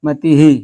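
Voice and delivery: मतिही with the final ह echoing इ as ही; visarga echoes the vowel. Shown as a demonstration. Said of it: "क्या हो जाएगा, मतिही".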